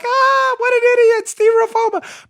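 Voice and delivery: very high, loud mocking hoot; jeering crowd. Host acts out everybody laughing at his blunder, jeering. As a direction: hoot it like a crowd making fun. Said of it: "Everybody started laughing".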